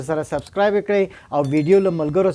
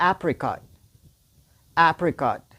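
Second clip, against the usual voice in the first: ordinary talk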